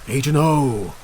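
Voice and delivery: high, loud grudging give-in; grudging concession. Acted. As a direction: humor him with a loud sigh of a yes. Concedes loudly, humoring robot.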